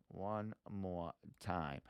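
soft, slow and low; slow, hushed aside to self; quiet and drawn out. Real, not acted. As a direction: say it slowly under your breath. Same words before the sung take.

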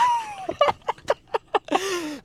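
high shrieking laugh. Real, not acted. Caught off guard by the voice.

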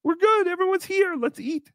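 coaxing. Retold or imitated. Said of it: "But I said".

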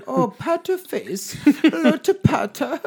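put-on a clumsy try at the old lady; mock Japanese woman. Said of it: "I'll give him an A for effort".

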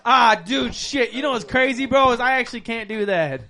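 imitating scared friend. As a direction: play up his panic with a laugh. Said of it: "He said".

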